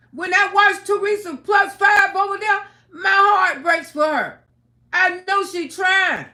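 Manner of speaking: loud, impassioned shouting rant; angry rant. Real, not acted. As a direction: defend struggling mothers at full voice. Heated rant defending mothers.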